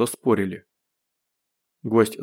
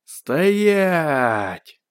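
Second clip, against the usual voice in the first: high, drawn-out shout; bellowed order; 5 semitones higher than usual. A narrator's reading. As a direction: bellow it out over a loudspeaker, stretching the vowel. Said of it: "динамик проорал на всю округу". (протяжный окрик)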